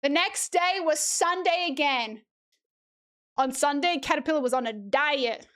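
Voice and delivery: storybook read-aloud, rising as hunger builds; reading to children. Acted. Streamer reads The Very Hungry Caterpillar to chat, storybook voice. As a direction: read it like story time, lifting each still hungry.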